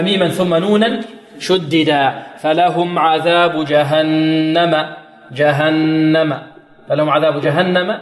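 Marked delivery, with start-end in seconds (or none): 2.4-8.0 جهنم with the noon held and nasal; nasalized doubled noon. Shown as a demonstration. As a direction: hum the shadda on the noon. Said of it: "النون مشددة، غن". (غنة النون المشددة في جهنم)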